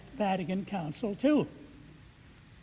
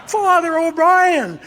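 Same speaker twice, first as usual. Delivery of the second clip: much higher and louder, drawn out slowly; excited greeting; 9 semitones higher, 11 dB louder, slower than usual. Retold or imitated. Hannah spotting her old teacher across the restaurant, excitedly. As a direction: call his name out in delighted recognition.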